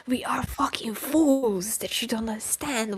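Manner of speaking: harsh angry whisper. Real, not acted. Streamer whisper-ranting at the writer. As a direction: spit it out through clenched teeth.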